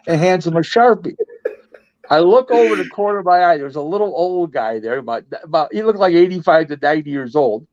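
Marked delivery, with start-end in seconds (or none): none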